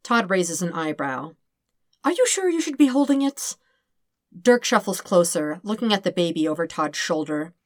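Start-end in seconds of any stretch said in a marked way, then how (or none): 2.0-3.6 anxious. Acted. Dirk anxious, Todd holds baby.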